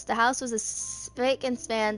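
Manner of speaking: ordinary talk, nothing marked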